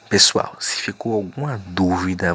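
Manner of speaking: normal talking voice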